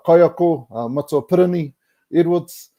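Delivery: normal talking voice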